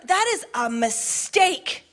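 slow, measured warning; slow statement. Acted. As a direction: let each word land. Stated slowly, then repeated.

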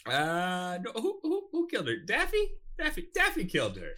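high, flustered stammer; high nervous voice. Acted. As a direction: squeak it out nervously.

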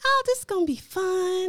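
high, bubbly elf voice; christmas elf. Retold or imitated. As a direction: chirp it like a cheerful Santa elf. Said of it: "Did you just put your elf voice on right there?".

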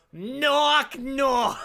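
loud, high, hammed-up Boston accent; terrible Boston accent. Cop telling a knock-knock joke, terrible loud Boston accent. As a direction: belt it out in an exaggerated Boston drawl. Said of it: "warning, here comes terrible Boston accent".

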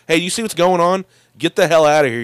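mild mock yell to a bystander; mock warning shout. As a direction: call it out to warn her off. Denji warning office worker.

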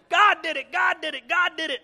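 high, very loud ecstatic yell; acted frenzied praise shout; screaming. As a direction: scream it like someone dancing with joy.

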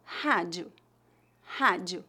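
"Rádio" low, ending in a faint oo; weak final U. Shown as a demonstration. Teacher showing learners final O, weak final U. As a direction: say "rádio" as HA-jee-oo, last vowel weak. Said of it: "como um U fraco".

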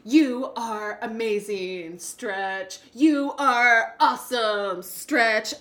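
slow, emphatic pep-talk to self; upbeat affirmations. Shown as a demonstration. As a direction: chant it like a hype mantra. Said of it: "So I will be saying things like".

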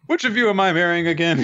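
high-pitched comic cluelessness; mock confusion. Joking about forgotten lore, high mock confusion. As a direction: ask it in a put-on high voice.

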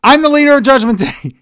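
loud, high angry outburst; hammed-up furious shout; Priest losing his temper. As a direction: yell it like a wrestler who has snapped. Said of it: "Cody makes Priest so mad".

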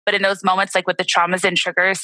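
ordinary delivery

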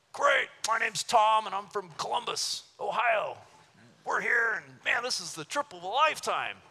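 high, put-on enthusiastic voice; imitating an overexcited stranger; eager tourist angler. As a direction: play a gushing, clueless vacationer.

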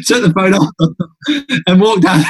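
telling it through real laughter; words broken up by chuckles; laughing. Real, not acted. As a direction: struggle to get the story out for laughing. Laughs while recalling grabbing the phone.

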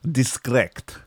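slow, stretched mock-German question. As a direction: draw every word out in exaggerated German.

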